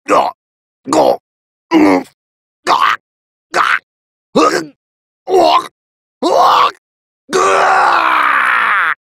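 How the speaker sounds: loud grunts of taking hits; short choked yells ending in a long scream; pain cries. Acted. Pain cries of a beaten villain.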